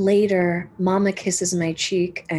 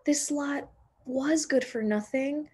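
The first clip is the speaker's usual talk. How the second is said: put-on higher, tender voice of an old man; touched old man; 7 semitones higher than usual. Old Man Hammer to Marcy, a lightly voiced softened old man. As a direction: let his heart soften on her name.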